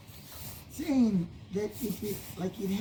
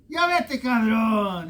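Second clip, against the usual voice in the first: retold shout; 2 semitones higher, 4 dB louder than usual. Storyteller tells children how he ran the creature off, shouting. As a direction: shoo the creature off, reenacted.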